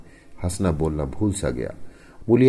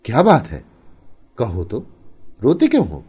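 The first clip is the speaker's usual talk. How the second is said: concerned, raised-pitch urging; anxious questioning; 5 semitones higher, 6 dB louder than usual. A narrator's reading. Husband asking why his wife is crying, anxious. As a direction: press for an answer, urgent and louder.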